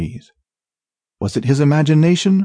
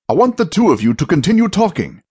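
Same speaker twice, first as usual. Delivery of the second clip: lightly voiced leader, pitched up; urgent command; 5 semitones higher, faster than usual. A narrator's reading. Arcturus keeping two lost companions talking, urgent command. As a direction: take charge of frightened companions.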